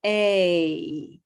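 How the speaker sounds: "A" sliding into a wide smile; gliding A sound. Shown as a demonstration. Teacher modelling the A glide again. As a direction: stretch "A" into a smile. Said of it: "to a nice big smile".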